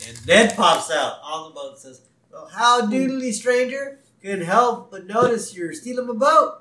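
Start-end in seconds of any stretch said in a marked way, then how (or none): 2.3-6.5 high, loud cheery neighbor voice; Ned. Retold or imitated. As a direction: chirp it out with hammed-up friendliness. Ned greets the boat thieves.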